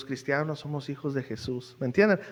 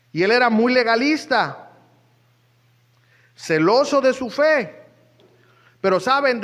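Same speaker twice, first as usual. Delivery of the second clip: preaching at full force; 4 semitones higher, 5 dB louder than usual. Real, not acted. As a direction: preach it hard over the congregation. Predica a toda fuerza. (predicando con toda fuerza, más fuerte y agudo)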